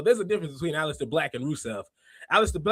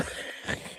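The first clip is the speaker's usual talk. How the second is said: breathy laugh; 14 dB quieter than usual. Real, not acted. Laughing at chat comment.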